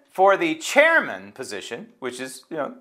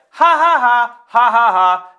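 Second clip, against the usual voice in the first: loud, high fake guffaws; sarcastic, hammed-up ha-ha-ha; mocking laughter; 9 semitones higher, 8 dB louder, slower than usual. Host mocks party members who just laughed, with loud fake laughter.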